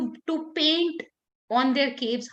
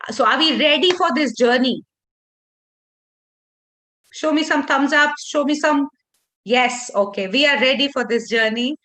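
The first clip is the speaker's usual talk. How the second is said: pumped-up call to audience; 6 dB louder than usual. Real, not acted. Rallying workshop audience for thumbs up.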